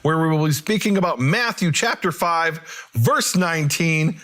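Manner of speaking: high, ringing show-opening voice; podcast host intro. Real, not acted. Host opening a Bible study episode, in a high presenter's delivery. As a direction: welcome listeners in a lifted, measured announcer tone.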